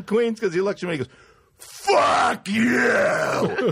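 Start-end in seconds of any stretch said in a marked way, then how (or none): 1.6-3.7 long, high shout; re-enacted outraged holler; drunken yell back. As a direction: bellow it up at a window. Drunk friend yells back at window.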